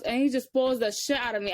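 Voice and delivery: ordinary delivery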